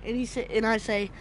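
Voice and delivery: normal talking voice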